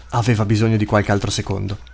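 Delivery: ordinary delivery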